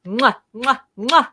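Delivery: big affectionate smacking air kisses; blown kisses. Real, not acted. Greeting a fellow nerd in the chat, loud blown kisses.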